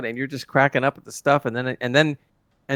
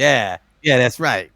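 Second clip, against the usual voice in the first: Spock as gangster; slower than usual. Retold or imitated. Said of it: "Spock trying to talk like the gangsters".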